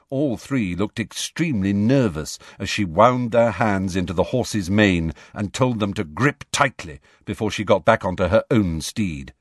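ordinary delivery, nothing marked

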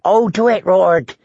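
high, eager fool's voice; high-pitched pleading. A narrator's reading. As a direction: beg gleefully in a high pitch. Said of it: "pleaded Shagwell".

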